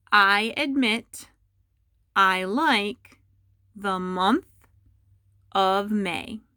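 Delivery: slow, word-by-word dictation; careful slow dictation to students. Shown as a demonstration. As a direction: read it out slowly so students can write. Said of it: "and I'll say it a few times".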